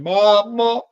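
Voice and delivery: high, wailing mock sob voice; hammed-up crying plea; imitating crying. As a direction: whine it out like a grieving son in tears. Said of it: "he's crying".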